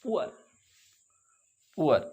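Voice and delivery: पुअर gliding oo into uh; oor sound. Shown as a demonstration. Teacher showing the oor vowel to students. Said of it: "ऊ और आ की आवाज़".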